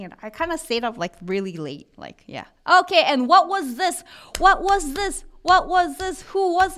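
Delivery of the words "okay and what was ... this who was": higher and louder, eager teacher asking; excited quiz question. Real, not acted. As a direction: pump up the class to answer. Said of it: "quizzing the class".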